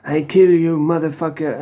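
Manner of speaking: lightly hammed-up accented shout at a victim; mock threatening shout. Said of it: "you can hear him shouting, screaming".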